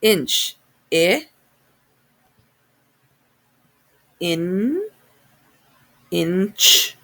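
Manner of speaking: inch built up sound by sound: I, in, inch; sounding out words. Shown as a demonstration. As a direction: stretch inch into its parts. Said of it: "Let's sound that word out".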